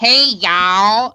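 mock Michigan accent, sinus-infection sound. Shown as a demonstration. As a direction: say it stuffed-up Michigan style. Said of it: "Say it with a Michigan accent".

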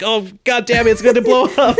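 panicked scientist. Retold or imitated. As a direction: yelp it like he's about to lose it. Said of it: "And he's like".

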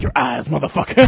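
loud, punched tough-guy catchphrase; Sergeant Dokes. Retold or imitated. Host joking about a kung fu eye gouge, loud mock Dokes. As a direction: belt out the parody one-liner. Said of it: "and he's like".

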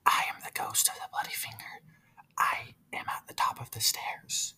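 hushed eerie ghost whisper; a ghost whispering up the stairs; spooky whispered ghost voice; ghostly whisper. Acted. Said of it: "he heard even louder".

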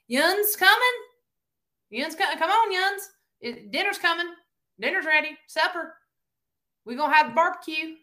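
high-pitched Tennessee twang; Tennessee neighbor. Acted. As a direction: call everyone to supper in a high Tennessee accent. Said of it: "this is how my neighbors speak".

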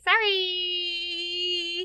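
high wailing sorry; whiny mock sob on sorry; fake crying. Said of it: "he's like".

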